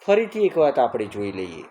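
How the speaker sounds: usual speaking voice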